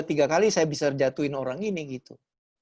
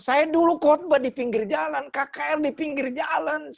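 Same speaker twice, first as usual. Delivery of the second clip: much higher, heated protest; indignant complaint; 9 semitones higher than usual. Retold or imitated. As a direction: argue your case to God, worked up. Complaint to God continues.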